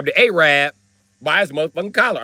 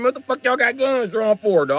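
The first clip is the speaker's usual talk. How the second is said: higher, incredulous question; indignant challenge; 6 semitones higher than usual. Retold or imitated. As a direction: question them in pitched-up disbelief. Challenges police with drawn guns.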